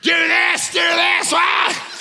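high, loud frantic commands; mock screaming orders. Dad screaming orders during the skunk face-off, mock frantic yelling. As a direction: yell orders in comic chaos. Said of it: "I'm screaming orders".

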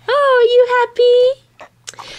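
high, slow delighted coo. Real, not acted. Cooing to baby.

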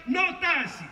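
chanting a campaign slogan, high; slogan shout. Retold or imitated. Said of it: "ha fatto tanta propaganda".

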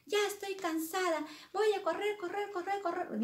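put-on high-pitched girl complaining; fed-up Alicia. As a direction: protest in a high girlish voice. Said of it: "se cansó y dijo".

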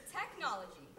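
ordinary talk

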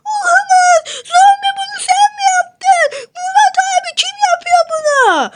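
yüksek, telaşlı bağırış; suçluyu arar gibi panikle sor. Acted. [name] suçluyu arıyor. ([name] zombiye ve [name]'a panikle bağırıyor)

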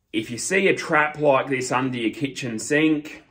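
normal talking voice

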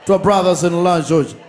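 high, loud announcer hype over music; hype MC intro. Acted. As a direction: pump up the crowd like an MC. Host hypes guest to crowd.

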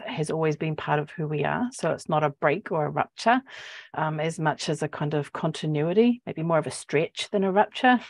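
ordinary talk

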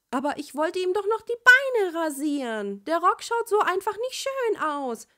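put-on high, loud childish whine; a kid sulking, wanting to keep playing; little sister voice. Little sister protesting bedtime, high and loud childish.

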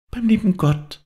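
put-on very high little child's voice; child's echo. A narrator's reading. Child echoes father's words of comfort, high little voice. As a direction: repeat it wonderingly, high as a small child. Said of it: "wiederholte das Kind".

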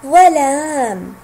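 teacher modelling ظلام; slow model word. Shown as a demonstration. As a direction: say ظلام slowly for the class. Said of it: "حرف الضاد جاء متحركا".

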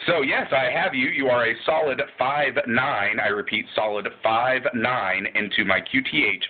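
normal talking voice

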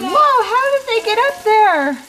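high, wide-eyed child voice imitating her daughter; amazed little girl. Mom imitating her amazed daughter at the calendar, high amazed child voice. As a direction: squeak it out like an awestruck kid. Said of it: "Amber just noticed, she said".

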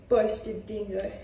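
usual speaking voice

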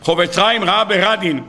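usual speaking voice

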